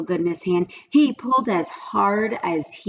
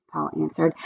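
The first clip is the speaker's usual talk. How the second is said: ordinary delivery, nothing marked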